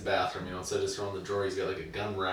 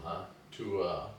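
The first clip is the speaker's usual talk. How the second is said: ordinary talk, nothing marked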